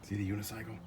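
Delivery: hushed, covert commentary; whispering. Real, not acted. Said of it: "Why am I whispering?".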